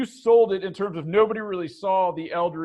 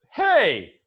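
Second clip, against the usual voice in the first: mock indignant "hey", higher and louder; startled guard; 5 semitones higher, 5 dB louder than usual. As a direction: call out in mild protest. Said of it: "he looks down and he's like".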